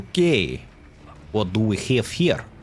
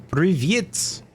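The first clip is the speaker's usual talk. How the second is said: slow, careful Russian greeting; spelling out Russian words; 7 semitones higher, much slower than usual. Pretend. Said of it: "I don't remember if that was good morning".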